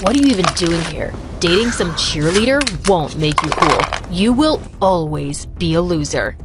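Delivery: low, menacing bully; Jake. Acted. Bully grabbing Scott by the collar, low threat. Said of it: "Jake grabbed him by the collar".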